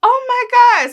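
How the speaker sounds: very high, loud mimicked squeal; imitating amazed child. Little cousin amazed at her magic hugs, high loud mimicked squeal. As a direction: squeal it like a thrilled little girl. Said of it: "So she's like".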